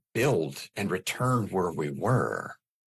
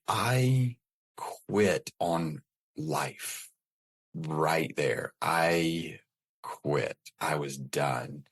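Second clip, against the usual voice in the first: calm adult voice; 3 semitones lower, much slower than usual. Real, not acted. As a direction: say it evenly, like a grown-up staying calm. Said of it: "like, in this calm adult voice".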